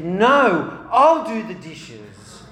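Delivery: loud, drawn-out insistence; forceful refusal. Retold or imitated. Daughter re-enacting dad refusing at the sink, forceful. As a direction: overact an argument over chores. Child re-enacts dad's supposed shove.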